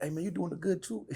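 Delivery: lightly voiced impression of a friend; Akkad's voice. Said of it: "he always talk like this".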